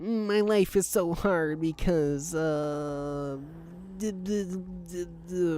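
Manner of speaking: high, slow, whiny put-on voice; whiny mock contestant. Mocking a reality show contestant, high and whiny. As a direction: pout it out like a self-pitying contestant.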